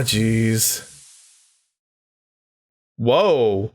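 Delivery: ordinary talk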